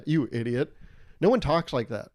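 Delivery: normal talking voice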